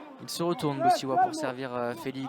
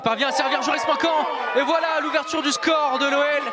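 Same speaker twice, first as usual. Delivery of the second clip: excited goal call; 6 semitones higher, 4 dB louder than usual. Real, not acted. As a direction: build up to a loud shout.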